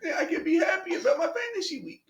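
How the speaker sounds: put-on high, pinched little kid voice; happy little kid voice.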